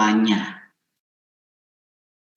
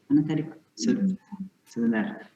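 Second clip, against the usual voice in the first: quiet aside; 2 semitones higher, 10 dB quieter than usual. Real, not acted. Host asks a helper where the question went, quiet aside. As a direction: softly ask off to the side.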